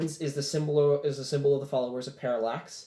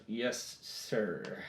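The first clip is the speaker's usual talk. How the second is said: low, soft, reluctant acknowledgement; grudging obedience; 4 semitones lower, 9 dB quieter than usual. Acted. Peloche answering his superior, grudging. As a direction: squeeze it out quietly and unwillingly. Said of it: "his face screws up and he goes".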